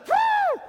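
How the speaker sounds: very high, loud imitated deer blow; deer blow whoo. Preacher imitating a deer snorting whoo at a hunter.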